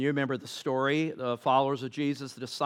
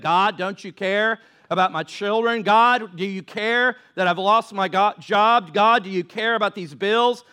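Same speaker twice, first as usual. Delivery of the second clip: higher and louder, pounding each plea; full-force preaching; 5 semitones higher, 6 dB louder than usual. Acted. Pastor crying out the congregation's prayers, then proclaiming God cares. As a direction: hammer the questions, then the answer.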